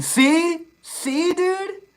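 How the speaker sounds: high excited squeal. Real, not acted. Streamer thrilled that his rapper-name joke landed.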